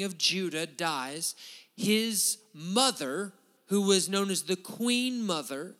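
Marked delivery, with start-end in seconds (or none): none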